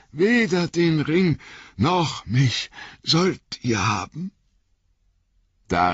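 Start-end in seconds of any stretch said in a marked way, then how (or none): 0.1-4.3 high, strained refusal, slowing toward the end; desperate defiant cry. A narrator's reading. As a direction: defy them with your last strength. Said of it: "rief Frodo mit letzter Kraft". (trotzig mit letzter Kraft)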